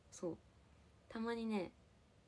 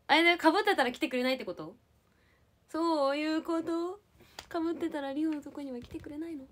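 a hint of a needy, pouting clingy girlfriend; 5 semitones higher, 5 dB louder than usual. Teasing chat, a mild clingy-girlfriend whine. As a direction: whine a little like she'll sulk if they don't come. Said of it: "メンヘラみたいな感じで言ってみたけど".

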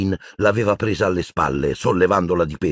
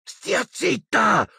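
much higher, very slow hushing shout; shrill cry; much slower than usual. Acted. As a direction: shriek at her to stop. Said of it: "strillò Hermione".

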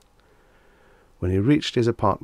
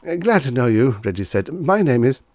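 flustered; 6 semitones higher, 6 dB louder than usual. A narrator's reading. Flustered Reggie.

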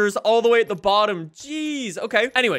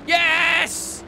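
usual speaking voice

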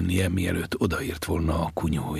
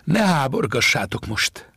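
raised, higher-pitched call; shouting back; 7 semitones higher, 5 dB louder than usual. Acted. Boy tells fishermen to let the old man sleep, calling out. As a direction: call out a firm order from afar. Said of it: "kiabálta vissza a fiú".

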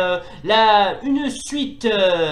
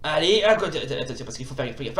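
ordinary talk

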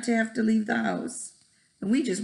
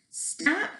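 cry; 10 semitones higher, 4 dB quieter than usual. Acted. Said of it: "cried Splat".

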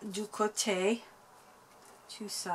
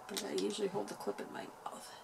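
whispering; 8 dB quieter, faster than usual. Real, not acted. Whispered aside, unvoiced.

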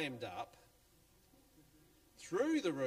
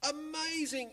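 high, loud cry of wonder; bursting exclamation of amazement; amazed yell; 14 semitones higher, 5 dB louder than usual. Acted. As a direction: shout it like a stunned crowd. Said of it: "yelled the crowd".

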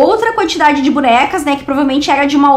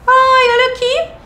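delighted squeal; 12 semitones higher than usual. Real, not acted. Delighted at a doll picture.

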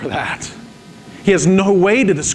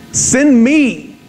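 intense Send me; 7 semitones higher, 4 dB louder than usual. Acted. Preacher voicing Isaiah answering God, loud eager offer. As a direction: Send me raised and louder. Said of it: "You get the intensity?".

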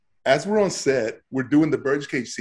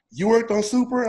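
surprised; 10 semitones higher than usual. Retold or imitated. Crew asks in amazement.

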